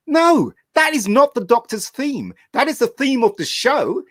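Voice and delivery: high, slow exasperated protest; mock outrage at the music; exasperated outburst. Fan complaining about the Doctor's theme, exasperated and high. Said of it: "I'm like".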